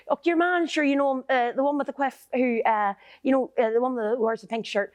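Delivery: imitating her ma. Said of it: "My ma is brilliant at doing".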